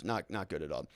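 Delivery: low, soft, heavy repeat; quiet grim verdict. Real, not acted. Streamer repeats his grim verdict, low and quiet. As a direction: let it sink, drop the voice.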